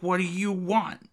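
ordinary delivery

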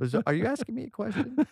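a touch of the Californian upswing, teasing; mock uptick. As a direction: turn each word up like a question. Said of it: "uptick voice".

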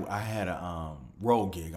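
ordinary delivery

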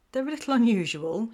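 amused about her odd parents; laughing. A narrator's reading. Alyssa laughing about her unusual parents. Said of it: "she laughed".

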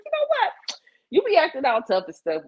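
high playful ribbing, a touch mocking; teasing mama. Mother teasing her tough-acting son, high playful ribbing. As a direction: tease him like a mama who sees through him.

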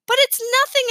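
scoffing, dismissive protest, pitched high; arguing. A narrator's reading. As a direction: brush it off as worthless, voice jumping up. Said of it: "Alex argued".

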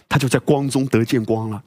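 ordinary talk, nothing marked

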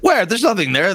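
high, defensive protest; flustered denial. Acted. Innkeeper stirring the soup, flustered denial. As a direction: deny it nervously.

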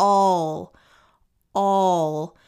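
"All" with the L pulled up and back; lifted L. Shown as a demonstration. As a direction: stretch out "All" and let the L ring. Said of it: "Puxa esse L lá pra cima".

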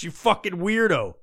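normal talking voice

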